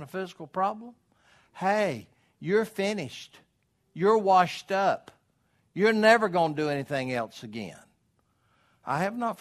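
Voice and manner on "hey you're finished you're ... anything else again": devil taunting. Retold or imitated. Preacher voicing the devil to a sick man, mocking sneer.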